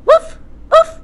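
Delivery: high squeaky puppy woofs; puppy bark. Acted. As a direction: yip like a little puppy. Puppy barks.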